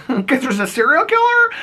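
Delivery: high incredulous question; mock disbelief. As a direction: act like it's obvious. Voicing the dad incredulous.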